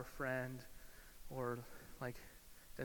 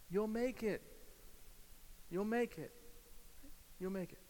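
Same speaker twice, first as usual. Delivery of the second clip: higher-pitched calls of encouragement; acted calling voice, raised pitch; 6 semitones higher than usual. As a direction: call out to urge a climber on. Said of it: "voice you trust that's calling out".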